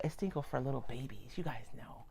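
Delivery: whispered aside to viewers; unvoiced confidential aside. Real, not acted. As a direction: lean in and whisper.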